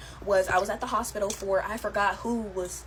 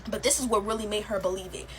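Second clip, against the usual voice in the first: ordinary delivery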